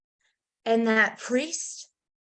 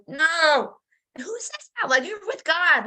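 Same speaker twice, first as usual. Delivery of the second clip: incredulous protest; 6 semitones higher, 3 dB louder than usual. Retold or imitated. Pouting protest to God.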